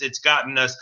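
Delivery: normal talking voice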